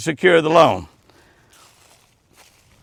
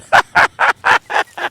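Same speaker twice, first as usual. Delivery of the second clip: big laugh. Real, not acted. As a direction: laugh hard at the punchline. Laughing at own joke.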